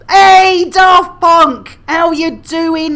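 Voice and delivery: loud, high call-out; shouting a cheery hello; loud greeting. Real, not acted. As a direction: hail your friend across the room.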